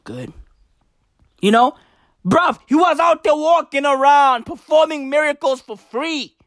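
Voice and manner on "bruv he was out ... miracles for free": hyped shout. Acted. Comic bigging up Jesus to the crowd, shouting high. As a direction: yell it like a mate bragging.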